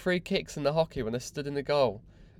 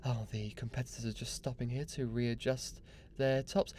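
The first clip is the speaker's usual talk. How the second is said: a soft, hushed commentator voice; lightly imitating a TV sports commentator; mock commentator; 3 semitones lower, 9 dB quieter than usual. Sneering at the judo commentator, hushed commentator voice. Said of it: "the commentator's like".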